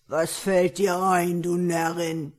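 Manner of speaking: loud, surly ogre growl; gruff grumble. A narrator's reading. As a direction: growl like an ogre jolted from sleep. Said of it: "brummte unwirsch". (unwirsch brummender Menschenfresser)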